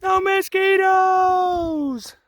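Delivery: high, drawn-out cheer of delight; excited shout. Real, not acted. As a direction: whoop it out with joy. Joyful shout about no mosquitoes.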